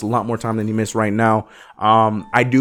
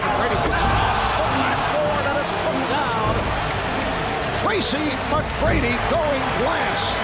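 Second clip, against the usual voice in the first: broadcast call clip; 14 semitones higher, 5 dB quieter, slower than usual. Real, not acted. Plays a game call clip.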